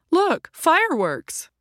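high, loud and drawn-out cry of delight; excited exclaim. Acted. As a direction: call out slowly, thrilled at the sight. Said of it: "he was the one who exclaimed".

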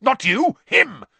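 high, loud growled correction. A narrator's reading. Moody jerks his thumb at Crabbe, growling loudly. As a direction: growl it out forcefully. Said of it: "Moody growled".

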